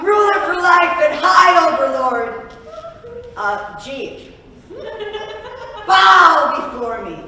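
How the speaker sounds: pompous overlord proclaiming; booming demon lord. Acted. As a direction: announce yourself as supreme ruler. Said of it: "Welcome to the kingdom of [name]".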